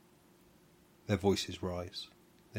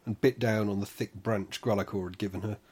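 plain reading voice

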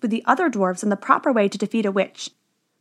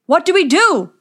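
loud, higher-pitched wail; panicked plea from the dwarves; distressed cry; 7 semitones higher, 6 dB louder than usual. A narrator's reading. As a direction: cry out helplessly, voice raised. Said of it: "they cried".

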